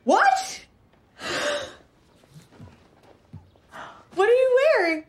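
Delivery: high, loud disbelief; shocked. Acted. Seeing what he is wearing, shocked high question. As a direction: gasp it out, stunned. Seeing his dance outfit.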